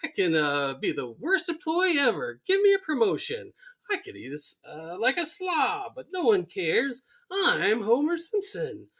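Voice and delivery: high-pitched manic mockery; Frank Grimes going nuts. Frank mockingly playing lazy Homer, manic. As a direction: rant as a derisive take on a slob. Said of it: "And he's just going nuts".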